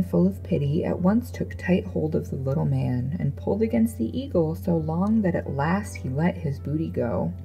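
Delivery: ordinary delivery, nothing marked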